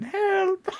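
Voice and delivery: thin, high cry for help; stretched, very high frail voice; frail old woman. Acted. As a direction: call out feebly as an old lady. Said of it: "This frail old woman".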